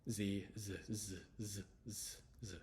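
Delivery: "Z" as a buzzed zuh; Z sound. Shown as a demonstration. Teacher drilling the last letter, isolated buzzing sound. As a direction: repeat "Z" as a separate buzzing consonant, fading. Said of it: "we have Z".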